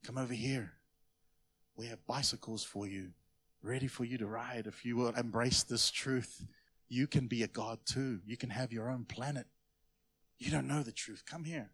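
soft, low coaxing stranger voice; hushed creepy enticing offer; luring stranger. Acted. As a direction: lure the kid in gently. Stranger luring child with bicycles.

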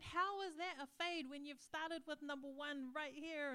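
drawn-out exasperation; retold indignant complaint. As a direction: act out demanding an explanation. Retold angry complaint to barber.

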